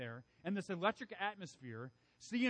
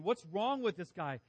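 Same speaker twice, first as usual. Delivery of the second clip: imitating an impatient, frustrated crowd, louder; 4 semitones higher, 6 dB louder than usual. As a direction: plead and complain like a let-down crowd. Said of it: "hearing this and wondering".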